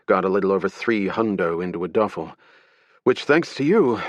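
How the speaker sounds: brief the agents like a tense security director; security director recounting the robbery. Acted. Security director voiced in story.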